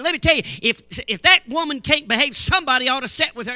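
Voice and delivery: high, loud scolding; retold anger. Preacher scolding the assistant pastor, a loud high rebuke. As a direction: scold the young pastor hard. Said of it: "Oh, I was mad".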